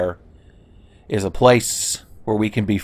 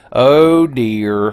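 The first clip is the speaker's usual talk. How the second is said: louder, drawn-out OH, louder and higher; louder oh dear; 3 semitones higher, 6 dB louder than usual. Shown as a demonstration. Streamer repeats it louder, bracing for the centipede demon. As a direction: lean on the "Oh" and push it out.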